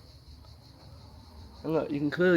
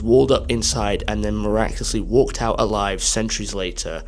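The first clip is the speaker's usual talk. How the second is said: lower, louder explanatory narration; projected, low travel-video narration; documentary voice-over; 4 semitones lower, 7 dB louder than usual. Real, not acted. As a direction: narrate the site's history to viewers. Recorded voice-over about the site.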